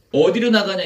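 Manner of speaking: lightly acted demon back talk, high and loud; defiant question. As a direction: imitate a challenge thrown back at the pastor. Demon answers back during exorcism.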